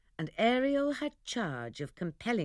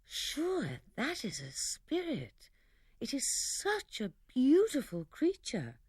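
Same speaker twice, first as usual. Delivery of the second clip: awed wonder; 7 semitones higher than usual. Acted. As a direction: breathe it out in awe. Miranda marvels at Ferdinand.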